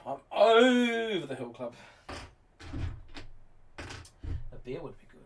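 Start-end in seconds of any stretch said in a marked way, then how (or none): 0.1-1.7 OVER stretched long, high and loud; drawn-out exclamation. Pretend. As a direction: crow it out, dragging the word over. Mock-announces getting old.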